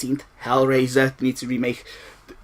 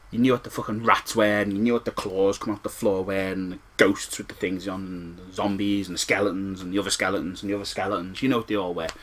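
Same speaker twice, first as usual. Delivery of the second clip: ordinary delivery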